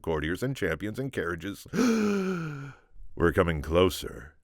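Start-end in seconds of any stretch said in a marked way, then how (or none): 1.7-2.7 sharp high intake of breath; awed gasp. Acted. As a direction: gasp in awe at the passing procession. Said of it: "soon she was gasping too".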